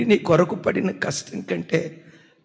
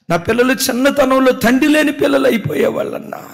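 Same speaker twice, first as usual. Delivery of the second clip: higher, louder fervent preaching; full-force preaching; 10 semitones higher, 8 dB louder than usual. Acted. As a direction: pour it out to the congregation with mounting force. (ఉద్రేకంతో బిగ్గరగా ప్రసంగం)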